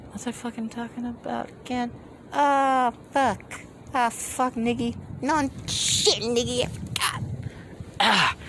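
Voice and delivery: high breathy cartoon girl voice, failing; squeaky anime voice attempt; high cute anime girl. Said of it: "if I actually try to do an anime voice".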